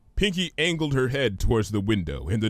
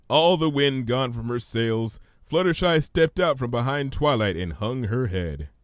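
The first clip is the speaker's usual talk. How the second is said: plain reading voice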